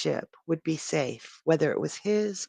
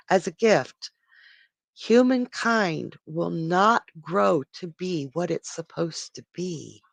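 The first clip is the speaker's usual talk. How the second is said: ordinary delivery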